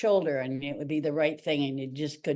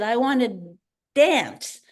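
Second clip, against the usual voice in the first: higher and louder, begging hard on dance; fervent plea; 4 semitones higher, 4 dB louder than usual. Retold or imitated. Young dancer pleading with the director, loud and high. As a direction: insist with all your heart.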